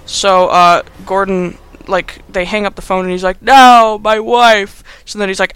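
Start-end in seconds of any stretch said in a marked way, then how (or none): 3.4-4.8 loud, higher hammed-up cry; mock anguish. Gordon after the kidnapper's call, mock anguished. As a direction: wail it in overdone despair.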